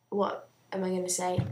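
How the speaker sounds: ordinary talk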